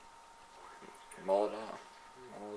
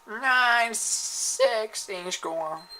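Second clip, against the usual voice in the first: high, loud, slowly drawn-out disbelief; surprised outcry; 12 semitones higher, 7 dB louder, much slower than usual. Real, not acted. Gamer seeing his own score, slow loud disbelief. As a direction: stretch out the shock at the score.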